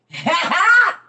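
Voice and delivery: high loud laugh; real laughing burst; loud burst of laughter. Real, not acted. Laughing at prophecy.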